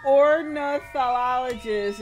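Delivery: put-on slow, loud drawled accent try. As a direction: stretch the word Ornithologist out slowly. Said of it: "that, that accent".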